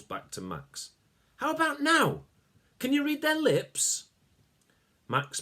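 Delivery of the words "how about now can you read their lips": put-on high, eager young voice; high eager boy voice. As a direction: ask it bright and keen. Sasha asks eagerly.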